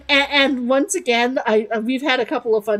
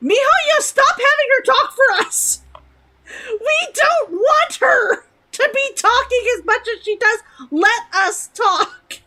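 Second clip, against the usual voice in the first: higher, louder plea to the developer; mock demand; 10 semitones higher, 4 dB louder than usual. Real, not acted. Streamer yelling at Mihoyo to cut Paimon's lines, louder and higher. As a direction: shout it at Mihoyo like a protest. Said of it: "This is a message directly for Mihoyo".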